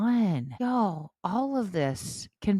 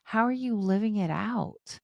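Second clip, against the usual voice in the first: ordinary talk, nothing marked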